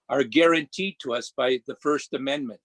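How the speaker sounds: ordinary talk, nothing marked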